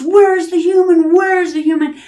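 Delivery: high, loud devil roar. A narrator's reading. Devil hunting the house for a human, roaring. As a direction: bellow it through the house. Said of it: "he's roaring around the house".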